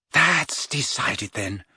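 ordinary delivery

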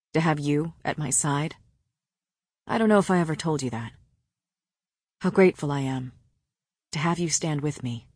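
lightly voiced man, pitched low; quiet, tender man. A narrator's reading. Man grateful for his mate beside him, tenderly. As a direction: confide it low and soft. Tender confession before battle.

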